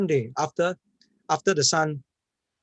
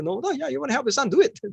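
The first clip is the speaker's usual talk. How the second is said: lightly voiced, slightly higher insistence; firm advice; 10 semitones higher than usual. Jim urging the mother to play with her son. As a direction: push her to do it.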